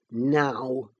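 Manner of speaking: low, held-in anger; restrained menace; curbed ferocity. A narrator's reading. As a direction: say it low, holding the temper back. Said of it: "with curbed ferocity".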